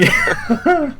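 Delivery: very high laughing yeah; squeaky laugh. Real, not acted. Answering the joke about dying tonight, laughing very high.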